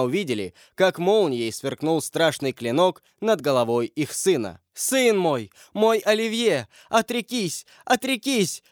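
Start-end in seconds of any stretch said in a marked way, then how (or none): 4.7-8.6 high, desperate plea, climbing in pitch; mother's imploring call. A narrator's reading. Mother begs her son to renounce his faith, imploring. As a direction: call out high, pleading ever more urgently. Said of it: "взывала к нему мать".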